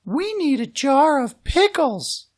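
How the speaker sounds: brisk, unworried mom; mother's voice. Acted.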